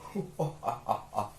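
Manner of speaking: acted belly laugh at someone; mocking laugh. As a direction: laugh tauntingly, as a bullying man.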